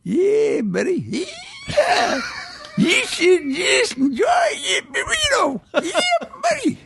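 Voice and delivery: screeching high American yell; American accent. Shown as a demonstration. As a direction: holler it like a wild old prospector. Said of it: "your favorite American accent".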